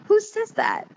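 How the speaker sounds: high mock disbelief; incredulous. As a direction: squeak the question up in disbelief. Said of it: "I'm like".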